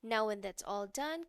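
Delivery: ordinary talk, nothing marked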